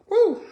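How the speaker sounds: high whoop after a cough. Real, not acted. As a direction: cough then let out a loud woo. Coughing from pepper heat.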